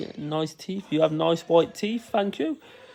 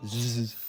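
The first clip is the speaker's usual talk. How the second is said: soft, sustained buzzing; bee buzz; 6 semitones lower, 11 dB quieter than usual. Pretend. Dad showing his child a bee sound, soft buzz. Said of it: "What does a bee sound like?".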